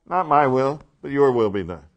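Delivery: loud rise on "my will", firm fall on "your will"; emphatic contrast. Acted. Teacher showing how easy it is, loud and emphatic. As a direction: set MY loudly against YOUR.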